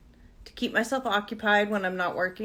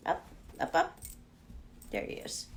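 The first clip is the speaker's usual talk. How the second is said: whisper; 5 semitones lower, 11 dB quieter than usual. Real, not acted. Lifting the dog up.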